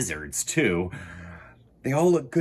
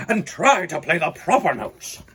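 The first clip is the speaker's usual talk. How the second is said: stern teacher; 4 semitones higher, 4 dB louder than usual. A narrator's reading. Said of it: "snaps Maestro".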